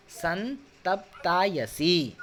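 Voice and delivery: chunk "संतप्तायसी" slowly and drill it; chunked slow practice. Shown as a demonstration. Teacher drilling a word for the class, very slow. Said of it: "ऐसे practice करेंगे".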